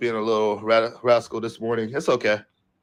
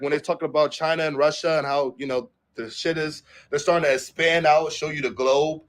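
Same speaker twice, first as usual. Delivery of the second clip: usual speaking voice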